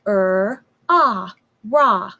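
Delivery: each sound stretched apart slowly. Shown as a demonstration. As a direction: sound out er, ah slowly, one at a time. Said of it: "sound it out really slowly".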